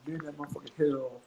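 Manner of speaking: usual speaking voice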